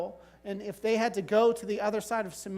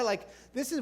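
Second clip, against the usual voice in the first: normal talking voice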